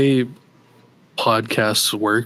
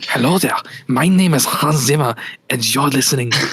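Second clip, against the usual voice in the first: put-on [name] German accent; 3 semitones higher than usual. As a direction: play it like a German composer introducing himself. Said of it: "do a German accent".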